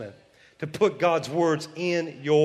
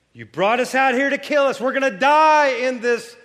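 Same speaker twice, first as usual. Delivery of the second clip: mock complaint; 6 semitones higher, 5 dB louder than usual. Israelites complaining in wilderness.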